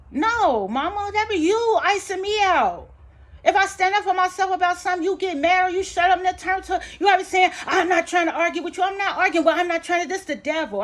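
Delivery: loud, high, fast-rising complaint at mama; heated argument. Retold or imitated. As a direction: fire back at your mother, exasperated. Acting out heated argument with mother.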